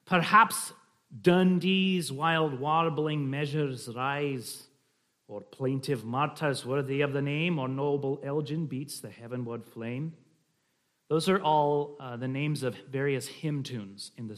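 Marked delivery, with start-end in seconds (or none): 0.0-10.1 measured Scottish verse reading; slight Scottish accent. Shown as a demonstration. Reading a poem naming psalm tunes, slight Scottish accent. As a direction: roll through the verse in light Scots. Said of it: "a slight Scottish accent".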